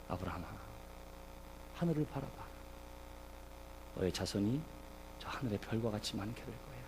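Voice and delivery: very soft, low voice of God; God quietly calling Abraham; hushed divine promise. Acted. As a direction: speak tenderly low, with long pauses. Voicing God gently showing Abraham stars.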